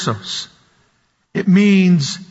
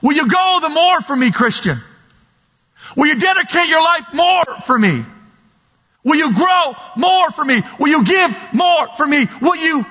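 voicing God, pitched higher, rising to shouted pleas; God begging his people to give more; preacher speaking as God, raised and urgent; God pleading; 7 semitones higher than usual. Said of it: "But He says".

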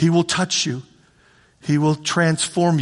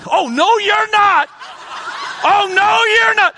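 booming high playful "no you're not"; loud, slow, teasing God stopping you; God refusing; 13 semitones higher, 4 dB louder, slower than usual. Acted. Pastor voicing God to the sinner, loud and teasing.

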